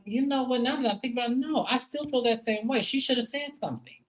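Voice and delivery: high, pressed and exasperated; high exasperated insistence. Real, not acted. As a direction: pitch up, insisting she should have told.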